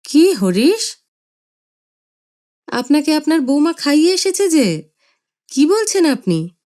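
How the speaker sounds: baffled disbelief; puzzled son. Acted. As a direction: son asking his father. Son questions father.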